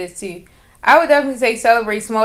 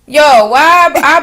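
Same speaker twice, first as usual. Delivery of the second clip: loud drawn-out yo; 6 semitones higher, 9 dB louder, much slower than usual. Real, not acted. Excited retelling.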